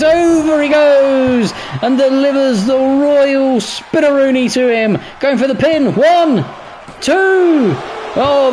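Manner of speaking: wrestling commentator. Acted. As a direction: scream the pin count like a main event. Finisher and pin count.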